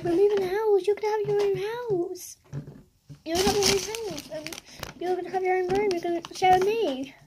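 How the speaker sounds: high, stretched-out upset retelling. Real, not acted. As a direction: pour it out high, close to tears. Tearfully retelling words said to dog.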